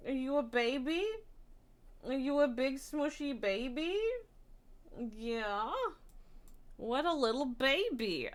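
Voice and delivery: cooing pet voice. Real, not acted. Cooing at her cat.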